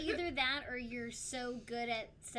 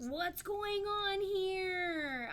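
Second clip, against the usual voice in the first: drawn-out, high and loud confused question; bewildered slow question; 8 semitones higher, 6 dB louder, much slower than usual. Retold or imitated. Waking to Gordon Ramsay on TV, a slow baffled what's going on. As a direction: stretch it out in disbelief. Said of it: "so I'm like".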